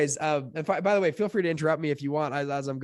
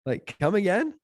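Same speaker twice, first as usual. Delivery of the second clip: higher-pitched baffled double take; retold disbelief; 6 semitones higher than usual. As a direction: act out a taken-aback reaction. Retold stunned reaction to news.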